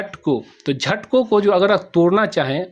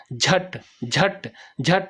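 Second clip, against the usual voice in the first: very slow, softer, each झट set apart; syllable demo; 5 dB quieter, much slower than usual. Shown as a demonstration. Teacher showing students a syllable beat, slow and soft. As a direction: drag out झट slowly, one at a time. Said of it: "थोड़ा आवाज़ ज़्यादा देना पड़ता है".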